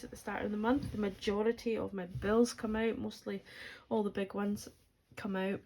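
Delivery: ordinary talk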